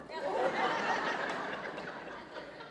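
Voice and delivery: a soft, high, unvoiced laugh; breathy laugh. Real, not acted. Pastor laughing at Dahlia's cheeky line, soft breathy laugh. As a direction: laugh at the memory.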